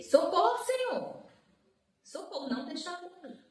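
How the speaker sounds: high, urgent plea to God; retold cry for help. Sister begging God not to let her die, high retold cry. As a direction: call out desperately, reenacting the panic. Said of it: "E eu gritei".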